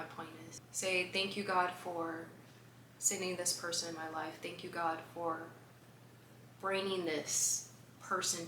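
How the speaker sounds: quiet, earnest thankful prayer; hushed grateful prayer to God; soft prayer. Acted. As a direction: pray it softly and sincerely. Models a gratitude prayer.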